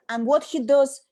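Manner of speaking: ordinary talk, nothing marked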